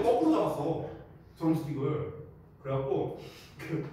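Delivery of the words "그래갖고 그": ordinary talk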